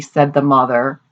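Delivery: ordinary delivery, nothing marked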